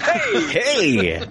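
hammed-up game show host greeting; radio host voice. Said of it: "cheesy game show host".

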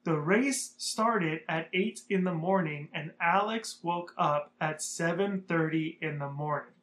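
slow, weighted retelling of the timing; slow, deliberate repeat. Shown as a demonstration. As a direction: race started said slowly for emphasis. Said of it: "I'm gonna say that again".

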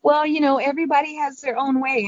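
grandmother, a high sweet tone; grandma's sweet voice. Retold or imitated. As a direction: coo it like a sweet old grandma. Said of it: "she's like".

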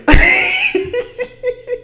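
bright embarrassed giggle burst; high laugh. Real, not acted. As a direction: giggle at yourself. Laughing at herself crying.

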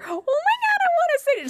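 very high-pitched mock squeal; excited fan squeal. Excited fan, retold squealing take.

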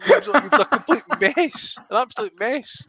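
breaks into high laughter mid-line; laughing. Real, not acted. Host laughing about the botched anthem, high laughing voice. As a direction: laugh through the words.

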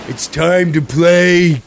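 hammy show host voice; game show host. Acted.

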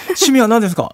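loud, high game-show host; mock emcee question. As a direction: quiz the fan like an emcee. Plays host quizzing fans.

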